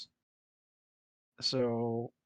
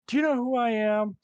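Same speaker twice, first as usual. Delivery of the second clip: high, loud mock celebrity outrage; indignant celebrity; 11 semitones higher, 6 dB louder than usual. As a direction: pull rank loudly, pitched way up. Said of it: "the celebrity's like".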